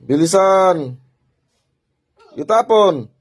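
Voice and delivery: loud, drawn-out one-word orders; shouted command. Real, not acted. Healer ordering spirit to hurry and throw it away, shouted command.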